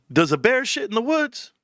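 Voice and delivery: usual speaking voice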